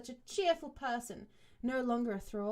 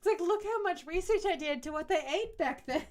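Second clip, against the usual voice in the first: mocking author voice; 7 semitones higher, 6 dB louder than usual.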